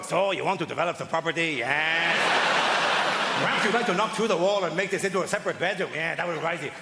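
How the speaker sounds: hammed-up Mexican accent; mock Mexican accent. TV expert arriving on a donkey, mock Mexican accent. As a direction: offer renovation advice in a cartoon Mexican accent. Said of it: "She can do the accent".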